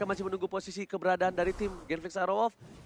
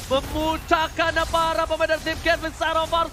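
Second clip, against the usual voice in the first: loud, high hype call; excited play-by-play; 6 semitones higher, 6 dB louder than usual. Acted. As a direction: call the kill streak at full force. Live hype commentary.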